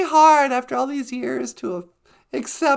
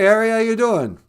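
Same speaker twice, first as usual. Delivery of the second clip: ordinary talk, nothing marked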